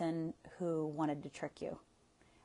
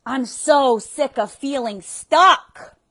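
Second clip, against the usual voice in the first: much louder, higher, slow frustrated cry; fed up; 8 semitones higher, 18 dB louder, much slower than usual. Shown as a demonstration. Coach crying out her frustration at being stuck. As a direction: let it all out, stretching "stuck". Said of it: "maybe you wanna say something like".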